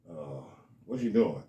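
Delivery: put-on nervous kid on the phone with his mom; sheepish kid. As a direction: shrink from mom's question.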